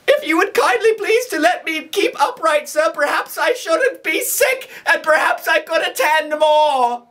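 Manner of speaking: put-on high, pleading boy's voice; frightened plea. Terrified boy pleads with the convict, high frightened. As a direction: beg to be set upright, scared. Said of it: "I was dreadfully frightened".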